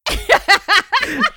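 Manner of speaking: high burst of laughter; real high laugh. Real, not acted. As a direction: crack up hard. Friend recalls roommate stealing.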